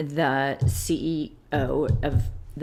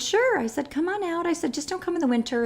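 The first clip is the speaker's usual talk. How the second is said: breezy politeness; 12 semitones higher than usual. Retold or imitated. Mock-polite invitation.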